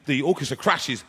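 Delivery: ordinary delivery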